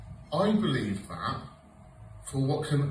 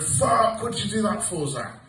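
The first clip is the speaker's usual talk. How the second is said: self-scolding outburst; 7 semitones higher, 3 dB louder than usual. Retold or imitated. Inner self-scolding after gaffe.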